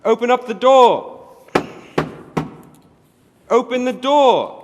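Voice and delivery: loud, bossy yelling to be let in; rough errand boy hollering; shouting at the door. Acted. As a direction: bang out the order. [name] bangs and shouts at door.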